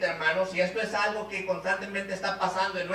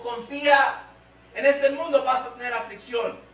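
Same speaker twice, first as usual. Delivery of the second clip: raised, louder proclamation; emphatic exhortation; 5 semitones higher, 4 dB louder than usual. Acted. Preacher voicing the Lord's command in affliction, emphatic exhortation. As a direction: call it out high and strong.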